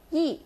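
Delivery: "yi" short and sharply falling; sharp fourth tone. Shown as a demonstration. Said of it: "You kinda say it sharply".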